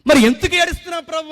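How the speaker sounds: loud, high plea "Prabhu"; pleading cry to God. Retold or imitated. Believer crying out to God, loud pleading question. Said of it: "అంటే".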